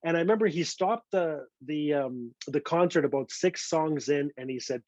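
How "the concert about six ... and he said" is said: ordinary delivery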